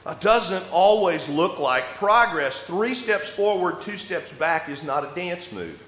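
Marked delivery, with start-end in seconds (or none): none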